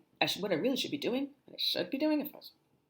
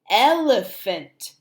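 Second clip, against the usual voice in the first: "elephant" slowly as el-e-phant, first beat strongest; syllable by syllable; 4 dB louder, much slower than usual. Shown as a demonstration. Teacher modelling a new word, "elephant" slowly in syllables. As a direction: stretch "elephant" into three clear beats. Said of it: "break it down by syllable".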